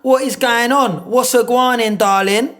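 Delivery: high loud Cockney geezer; loud, high cheeky Cockney greeting. Acted. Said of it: "Best roadman voice".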